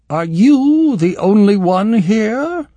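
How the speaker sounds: looking down her nose, loud and slow; disgusted. A narrator's reading. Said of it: "in accents of disgust".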